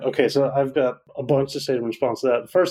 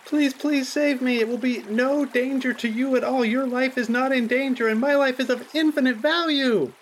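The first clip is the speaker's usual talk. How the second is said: put-on drowning child; 12 semitones higher than usual. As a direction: beg to be saved.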